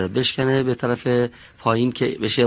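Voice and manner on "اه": normal talking voice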